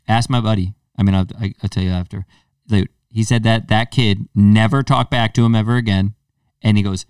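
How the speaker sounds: normal talking voice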